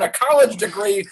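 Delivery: ordinary delivery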